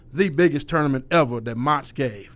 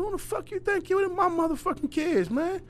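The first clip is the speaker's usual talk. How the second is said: higher, heated mock tirade; heated confrontation; 13 semitones higher than usual. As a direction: tear into the rival coach. Confronting the rival coach.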